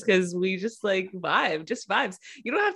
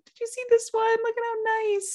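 high, delighted gushing; excited; faster than usual. Retold or imitated. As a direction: gush over a sweet comment. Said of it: "we're like".

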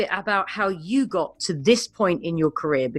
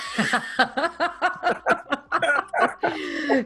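laugh; 7 semitones higher than usual. Real, not acted. Host laughs at the punchline.

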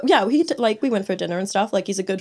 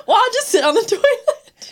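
much higher mock man's voice, laughing; retold guy's quote; 14 semitones higher, 3 dB louder than usual. Storyteller quotes client choosing the toilet seat, high mocking voice. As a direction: put on his high, oblivious voice. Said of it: "your guy was like".

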